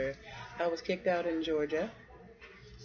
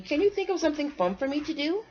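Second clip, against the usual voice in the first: put-on pleading kid; 11 semitones higher, 6 dB louder, faster than usual. Squirrel pleads for fun.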